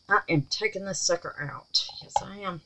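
conspiratorial whisper. Real, not acted. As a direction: whisper it like a little secret. Whispered resolve while unmolding.

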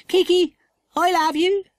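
a lightly voiced timid girl; little girl voice. Tassie figure voice.

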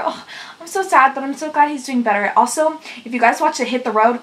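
ordinary talk

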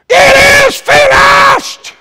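very high, loud, drawn-out cry; shouted scripture. Retold or imitated. Preacher quotes Jesus's final words, shouted scripture. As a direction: belt the verse out slowly at full force.

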